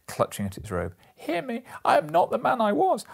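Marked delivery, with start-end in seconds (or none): 1.2-3.0 very high, loud pleading cry; desperate plea. A narrator's reading. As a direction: beg for mercy with all your strength. Scrooge pleads for change.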